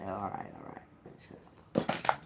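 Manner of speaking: mock whispered retort. Retelling a joking comeback to God, whispered. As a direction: drop to a breathy whisper, teasing. Retold reply ending in a whisper.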